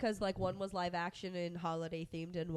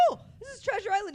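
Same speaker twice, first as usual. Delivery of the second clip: surprised realization; 11 semitones higher, much faster than usual. Retold or imitated. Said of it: "I'm like".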